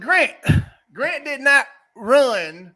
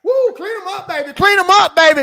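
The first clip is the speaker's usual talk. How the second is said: loud, high hype chant; hype call; 6 semitones higher, 5 dB louder than usual. Real, not acted. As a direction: cheer it out loud. Hyping up, calling out.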